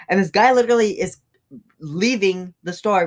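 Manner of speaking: ordinary talk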